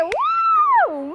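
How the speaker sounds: very high, sustained woo; admiring whoop. Acted. Class cheering whoever solved the sum, admiring whoop.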